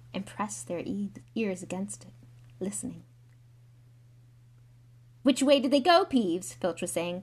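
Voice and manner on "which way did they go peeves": loud, higher demanding question; agitated caretaker questioning; urgent interrogation. Acted. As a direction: demand an answer at once. Filch interrogates Peeves.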